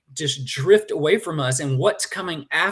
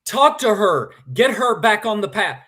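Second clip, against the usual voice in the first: raised, urgent commands; guide shouting orders; 6 semitones higher, 6 dB louder than usual. Retold or imitated. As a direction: bark directions from the guide. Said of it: "'cause he's going".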